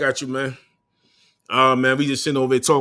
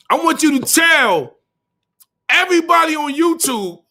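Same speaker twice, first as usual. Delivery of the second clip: higher mocking challenge; taunting; 12 semitones higher than usual. Real, not acted. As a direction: goad him in front of everyone. Taunting rival, high pitched.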